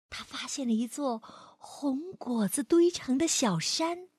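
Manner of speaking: put-on gentle storyteller reading to a child; lively read-aloud for kids; expressive bedtime-story voice; story narration. A narrator's reading.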